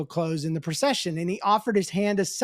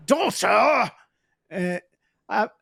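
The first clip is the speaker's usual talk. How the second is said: shocked Scottish daughter; 3 dB louder, slower than usual. Retold or imitated. Drunk Scot startled by the daughter, shocked Scottish shout. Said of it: "say it for me".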